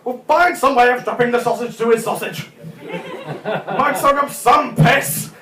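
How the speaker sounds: bossy wife, breaking into laughter at the end; exasperated wife. Retold or imitated. Wife orders husband about nappy, mock exasperated.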